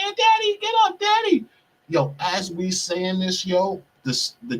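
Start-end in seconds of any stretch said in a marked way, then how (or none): 0.0-1.5 high childlike plea, mocking; imitating crying child. Joking with barbers about the boy, mock high child's plea. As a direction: ape a little boy begging his dad to get up. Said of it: "how the little boy was saying".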